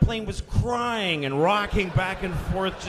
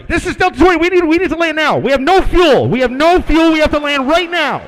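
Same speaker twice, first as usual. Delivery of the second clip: high, very loud desperate shouting; panicked pilot; 7 semitones higher, 16 dB louder, faster than usual. Acted. Pilot begging to land with no fuel, panicked yell. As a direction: yell the emergency into the radio in panic.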